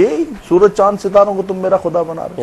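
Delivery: disbelieving question, swinging up high at the end; rhetorical question of denial. Shown as a demonstration. Scholar showing how tone denies a claim, rhetorical question. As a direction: ask it as if the very idea is absurd. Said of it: "इस्तिफहाम इनकारी में आ गया".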